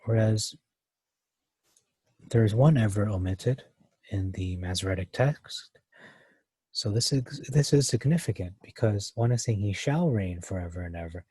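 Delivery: ordinary delivery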